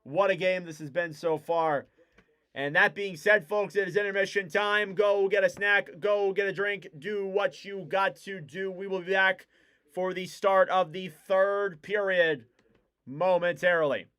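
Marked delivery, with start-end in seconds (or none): 2.5-14.1 friendly broadcaster sign-off; host voice for the break; intermission announcement. Acted. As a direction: send the audience to break.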